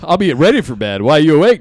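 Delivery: ordinary talk, nothing marked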